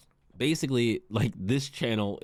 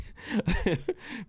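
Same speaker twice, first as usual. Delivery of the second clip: laugh. Real, not acted. Laughs at own bleak joke.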